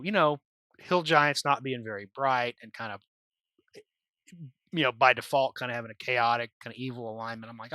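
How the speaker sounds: ordinary delivery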